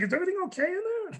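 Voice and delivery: the nurse knocking, asking if all is well; concerned nurse. Retold or imitated. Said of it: "She's like".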